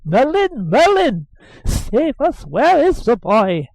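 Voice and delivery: high shrill call across the garden; shrill nurse calling for the boy; calling shrilly. A narrator's reading. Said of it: "my nurse's voice called shrilly".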